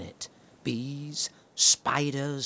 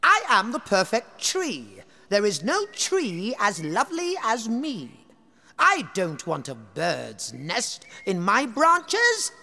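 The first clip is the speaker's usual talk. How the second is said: vain tree; 7 semitones higher than usual. Acted. The tree refusing animals, haughty and high. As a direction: sniff it out haughtily. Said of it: "said the oak tree".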